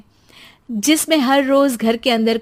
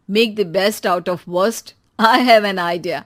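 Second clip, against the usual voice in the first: grand announcement; 3 semitones lower, 6 dB louder, much slower than usual. Acted. Father declares idea.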